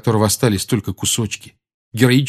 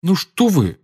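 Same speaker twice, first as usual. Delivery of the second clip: protesting; 9 semitones higher, slower than usual. Acted. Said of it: "запротестовал Гарри".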